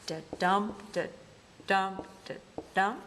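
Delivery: da-dum, da-dum, da-dum tapped out as rhythm; rhythmic da-dum beat, stress on dum; iambic beat. Shown as a demonstration. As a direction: mark the iambic beat: weak da, strong dum. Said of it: "take the rest of the line".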